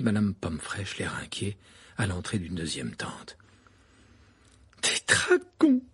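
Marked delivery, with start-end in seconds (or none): 4.8-5.8 high, slow disgusted exclamation. A narrator's reading. Madame Pomfrey exclaiming about dragons, disgusted. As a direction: spit out your disgust. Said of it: "d'un ton dégoûté".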